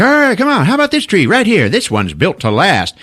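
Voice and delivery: high, loud opening pitch settling down; pushy salesman. Retold or imitated. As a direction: hustle them like a tree-lot salesman. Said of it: "the salesman, is like".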